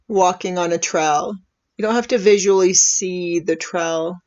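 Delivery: calm, unhurried meditation voice with long pauses; soothing, measured invitation to breathe and settle; guided meditation. Real, not acted. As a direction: gently guide listeners into relaxing, leaving space after each phrase.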